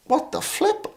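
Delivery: a high, soft squeak of disbelief; shocked thought. Retold or imitated. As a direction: squeak it out, stunned. Shocked by pagan's prophecy.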